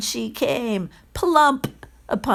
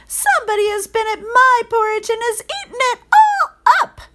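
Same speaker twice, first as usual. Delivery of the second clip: much higher, louder tiny bear voice; little wee bear; 7 dB louder than usual. A narrator's reading. Wee bear finds his porridge eaten, tiny high voice. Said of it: "in his little small wee voice".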